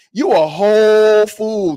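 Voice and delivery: slow, loud, drawn-out scolding; scolding the commenter, slow and loud; indignant scolding. Real, not acted. Vlogger scolding a rude commenter, slow and loud.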